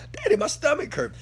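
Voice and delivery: high, whiny little girl voice, a mock whimper; imitating crying daughter. Father imitates his hungry crying daughter, high and whiny. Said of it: "she is crying".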